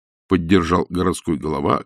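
plain reading voice

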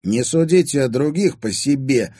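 curt rebuff. A narrator's reading. Timofey rebuffing stepmother's accusations, curt. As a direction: cut her off decisively. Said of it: "отрезал Тимофей".

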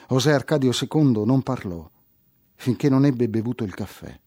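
plain reading voice

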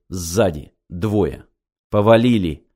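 ordinary delivery

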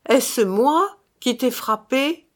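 high, slow rising challenge; insistent interrogation. A narrator's reading. Brother interrogates the dead man, slow and insistent. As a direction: press each word of the question slowly.

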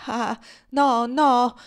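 high, loud pleading no; alarmed protest. A narrator's reading. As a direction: cry out to stop her. Tom recoils and protests. (protesta accorata ad alta voce)

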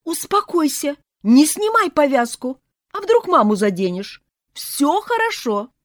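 high, loud, pleading; frantic aunts. A narrator's reading. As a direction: hurry to calm him down. Aunts calming nephew.

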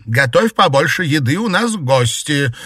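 high, loud order; loud shout. A narrator's reading. Mistress ordering the maid in the kitchen, shouting. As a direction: call it out to another room. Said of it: "громко крикнула".

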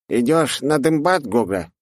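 a goblin asking his mate. Acted. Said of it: "спросил один гоблин другого". (голос гоблина)

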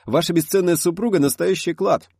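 high, loud enthusiastic praise; excited. A narrator's reading. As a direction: gush over her, pitch way up. Director's enthusiastic exclamation.

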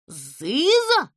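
loud astonished cry; the animals gasping in disbelief; gasp of shock. A narrator's reading. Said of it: "ахнули звери".

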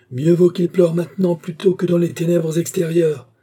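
high, loud pronouncement of doom; fanatical preaching. A narrator's reading. Crazed worker answers the mother with damnation, fervent preaching. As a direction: proclaim like a zealot.

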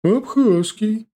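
put-on high, loud old trader's voice; creaky old woman voice. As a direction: answer like a loud old market granny. Old vendor names origin.

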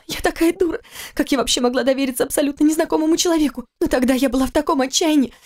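высокий женский голос, кается и боится; жена в отчаянии винит себя; виноватая жена. Acted. Героиня Кира кается. (высоко, жалобно, к концу медленнее)

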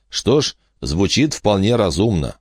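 ordinary delivery

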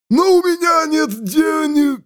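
high wailing shriek of a corpse. Acted. Corpse shrieks that he has no money. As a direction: shriek it out in despair.

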